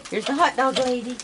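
loud, high sing-song chant to the animals; sing-song calling. Real, not acted. Calling the raccoons to come get hot dogs, loud sing-song. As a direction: call it out like ringing a dinner bell.

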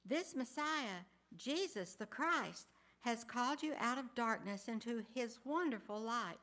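ordinary talk

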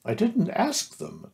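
high, slow, lightly offhand; careless Rabbit. A narrator's reading. Rabbit explains his friends and relations, mildly careless. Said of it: "explained Rabbit carelessly".

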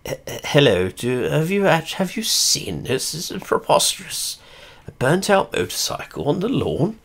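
mock-posh indignant letter to the police; outraged old man. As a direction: play the scandalised pensioner writing in. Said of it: "so I was on Twitter going".

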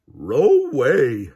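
loud, put-on talking-dog refusal; Scooby's dog voice. A narrator's reading. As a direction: refuse loudly in dog speech.

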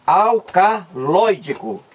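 "Alcaloídico" at half speed, al-ca-loi-dico; syllables separated. Shown as a demonstration. Teacher reading back the separated syllables, "Alcaloídico" said slowly in parts. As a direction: slowly sound out "Alcaloídico" in short pieces. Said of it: "vamos separar aqui a sílaba".

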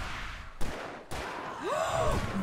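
breathy gasp of alarm; long shocked gasp. Real, not acted. Streamer seeing a huge enemy, long shocked gasp. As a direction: suck in air in shock.

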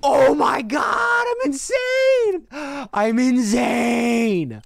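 slow ecstatic screaming; raging gamer. Real, not acted. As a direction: scream with triumph, drawn out. Big win celebration.

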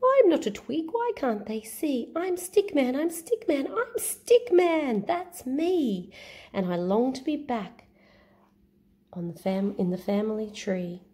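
high, sing-song little stick hero; Stick Man voice. Acted. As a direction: chant the refrain brightly, stretched out. Stick Man's refrain.

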